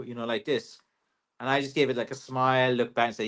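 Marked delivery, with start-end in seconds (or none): none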